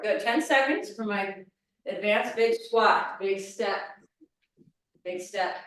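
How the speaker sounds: ordinary talk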